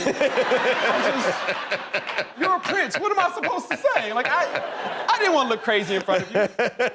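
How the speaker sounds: high-pitched helpless babble through laughter; comic panic, cracking up; flustered, laughing protest. Retold or imitated. Flustered before a prince, retold.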